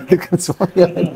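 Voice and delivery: chuckling through the words; laughing. Real, not acted. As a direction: laugh as you say it, amused. Joking about wife seeking another husband. (sambil tertawa geli)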